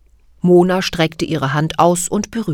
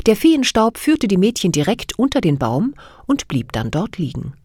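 usual speaking voice

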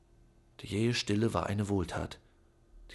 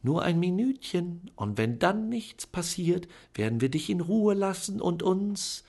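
sweet coaxing; 7 semitones higher than usual. A narrator's reading. Aunt bargaining with Charlie, sweetly coaxing. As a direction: promise him peace in a sugary tone. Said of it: "flötete Tante Venetia".

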